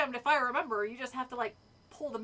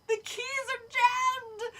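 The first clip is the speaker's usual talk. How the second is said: high, drawn-out, loud wail; panicked shout; 11 semitones higher, 5 dB louder, much slower than usual. A narrator's reading. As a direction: wail slowly that all is lost. Panicked shout at jammed typewriter.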